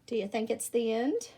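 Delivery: ordinary delivery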